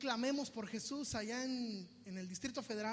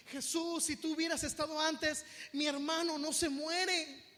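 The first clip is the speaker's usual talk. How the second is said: raised, loud lament; mock wailing complaint, drawn out at the end; tearful reproach; 5 semitones higher, 5 dB louder than usual. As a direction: imitate a grieving sister pleading, without real sobs. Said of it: "le salen llorando y le dice".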